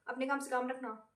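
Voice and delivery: curt retort. Retold or imitated. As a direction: brush her off under your breath. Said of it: "And I'm like". (धीमी, रूखी झिड़की)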